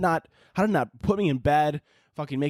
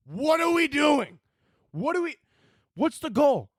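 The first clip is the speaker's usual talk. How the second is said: much higher, drawn-out outraged cry; voice shooting up; 9 semitones higher, slower than usual. Real, not acted. Host still fuming over Twitter's bans, in a slow, high cry. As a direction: stretch it slowly up in disbelief. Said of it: "My voice just had to go up eight octaves".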